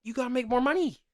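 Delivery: high, insistent; exasperated. Real, not acted. Host presses cohost, high and exasperated. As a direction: drive the point home.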